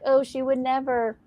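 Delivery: ordinary talk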